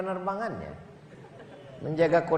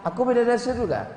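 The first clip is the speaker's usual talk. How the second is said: higher, quick delighted question; lightly voiced flattered wife; 5 semitones higher, faster than usual. Wife asking husband, a touch coy. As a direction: gush it, pleased.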